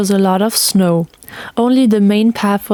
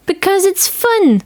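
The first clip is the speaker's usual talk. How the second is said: very high, bright shout; girl hopping backwards calling to her friend; gleeful girl; 11 semitones higher than usual. A narrator's reading. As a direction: squeal it with joy.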